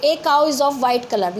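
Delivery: slow reading of the wrong version; slow wrong example. Shown as a demonstration. Teacher showing pupils the wrong sentence. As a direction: read the mistake slowly. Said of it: "यहाँ पे ऐसे नहीं लिख सकते".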